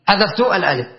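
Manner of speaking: usual speaking voice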